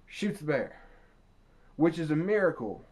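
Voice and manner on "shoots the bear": ordinary talk, nothing marked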